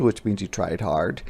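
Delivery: ordinary delivery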